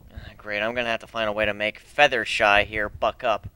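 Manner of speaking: ordinary delivery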